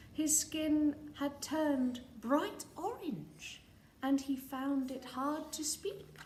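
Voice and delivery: ordinary delivery, nothing marked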